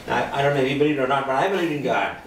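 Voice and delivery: ordinary talk, nothing marked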